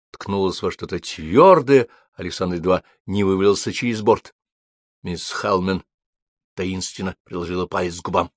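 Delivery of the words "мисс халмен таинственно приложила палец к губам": usual speaking voice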